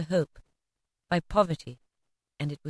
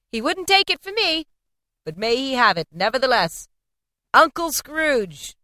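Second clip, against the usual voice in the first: 1 warm toast; 6 semitones higher, 3 dB louder than usual. A narrator's reading. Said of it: "said Scrooge's nephew".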